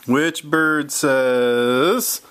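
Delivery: drawn-out slow which bird says; teasing slow quiz question. Shown as a demonstration. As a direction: stretch the question out to build suspense. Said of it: "repeats the question".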